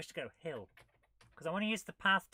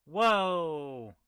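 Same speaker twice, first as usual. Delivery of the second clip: surprised whoa; 8 dB louder than usual. Real, not acted. Reacting to complex game screen.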